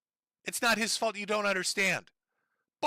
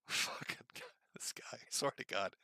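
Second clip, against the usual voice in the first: quiet, sighing disgust; exasperated muttering; 7 semitones lower, 13 dB quieter than usual. Real, not acted. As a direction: sigh it out, fed up. Exasperated sigh.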